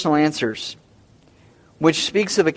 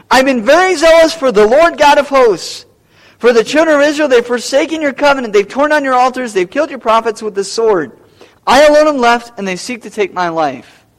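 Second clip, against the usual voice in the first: Elijah blurting; 5 semitones higher, 4 dB louder than usual. Acted. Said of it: "he said it so fast".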